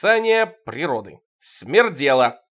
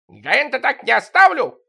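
loud, much higher yell; 10 semitones higher, 8 dB louder than usual. Acted. Sidorych yelling at the neighbors, loud and high. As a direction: shout the threat out. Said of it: "орал Сидорыч".

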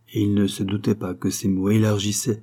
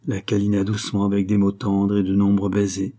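plain reading voice

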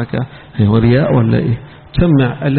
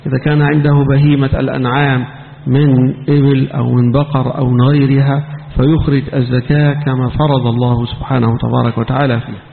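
ordinary talk, nothing marked

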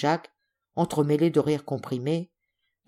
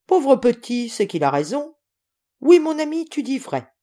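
hearty, good-natured admission; moved agreement; 8 semitones higher, 5 dB louder than usual. Acted. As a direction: exclaim fondly, high and emphatic at the start. Softened general agrees with the boy.

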